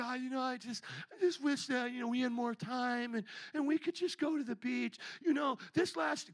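high, mock self-pitying moan. Retelling a car ride wish for the beach, self-pitying. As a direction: act out feeling sorry for yourself. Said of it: "The pity gets me".

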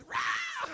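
loud, high imitated scream; retold scream at full force; sudden scream. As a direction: burst out in a roaring yell. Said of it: "I hear Glenn screaming".

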